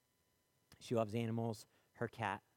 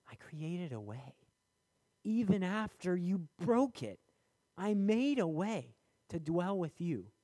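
rising, slower, earnest divine voice; voice of God; 8 semitones higher, 5 dB louder than usual. Acted. God calling his people back, voiced with lifted, slower delivery. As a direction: speak as God, lifting up and pleading. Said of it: "God says".

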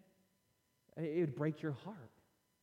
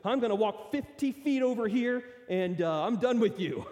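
higher and much louder, comic indignation; mock huffy exit; 6 semitones higher, 9 dB louder than usual. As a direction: play up the walkout for laughs. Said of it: "So he goes".

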